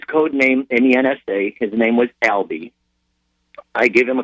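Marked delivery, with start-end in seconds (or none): none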